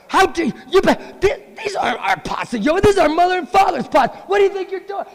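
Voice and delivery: very high, loud mock shriek; shrieking girls. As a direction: screech it like outraged girls. Gopis shrieking at broken pots.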